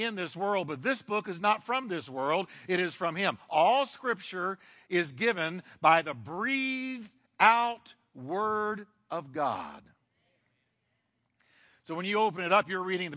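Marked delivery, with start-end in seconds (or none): 3.5-9.8 slow emphasis. Acted. Preacher restates key verse.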